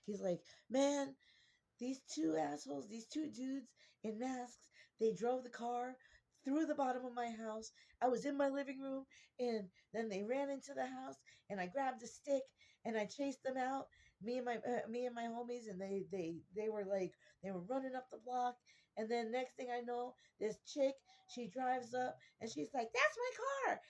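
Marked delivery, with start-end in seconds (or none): 0.7-22.9 loud, higher, breathless-run account of a friend; worked up and raised, tumbling through events; excited retelling. Retold or imitated. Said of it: "He's so excited about this". 22.9-23.8 high, loud mock yell of an outraged car owner; retold high-pitched shout; indignant shout. Woman finds her stolen car.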